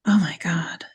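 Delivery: whispered oh my God in awe; hushed, awed whisper; breathless whisper. Real, not acted. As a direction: breathe it out, voiceless and amazed. Swooning over dessert description.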